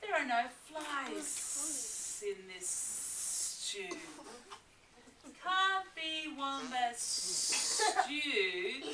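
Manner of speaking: slow, drawn-out lizard voice; old Blue Tongue Lizard, scornful; slow hissing lizard. Acted. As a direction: drag the words out like a lizard. Lizard speaking.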